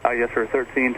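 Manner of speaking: usual speaking voice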